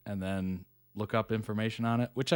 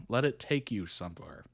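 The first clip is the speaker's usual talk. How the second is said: normal talking voice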